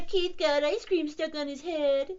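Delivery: high, teasing chuckle; amused jeering cat; chuckling. A narrator's reading. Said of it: "chuckled the other cats".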